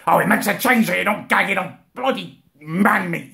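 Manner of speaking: ordinary talk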